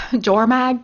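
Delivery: ordinary talk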